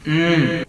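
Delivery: loud yummy hum; savoring moan; mm of delight. Real, not acted. First bite.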